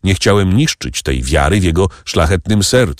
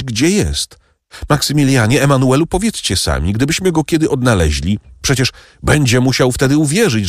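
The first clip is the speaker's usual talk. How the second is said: higher-pitched, urgent entreaty; earnest pleading; 7 semitones higher than usual. Acted. Julia begs the count for news.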